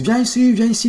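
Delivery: high-pitched mock calling; calling dogs. Owner calling dogs that don't obey, high mock call.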